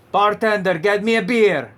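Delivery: playful bartender call; barroom order. Pretend. Puppet orders a beer jokingly.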